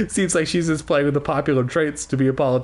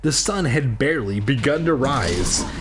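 ordinary delivery, nothing marked

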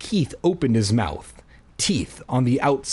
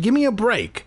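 snapping; 10 semitones higher than usual. Acted. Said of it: "I snapped".

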